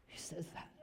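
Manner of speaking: soft unvoiced whisper; hushed aside. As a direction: murmur it under your breath.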